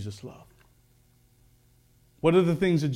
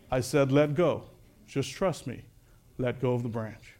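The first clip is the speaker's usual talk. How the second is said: low, soft voice of God; calm insistence; 5 semitones lower, 7 dB quieter than usual. Acted. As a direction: quietly ask for his trust. God's voice calmly insists.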